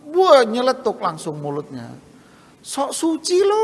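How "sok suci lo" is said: high, sneering jab from an angry salesman. Retold or imitated. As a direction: snap back like a furious salesman, pitched high. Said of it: "Ngamuk nih sales". (sales yang ngamuk, nada tinggi mengejek)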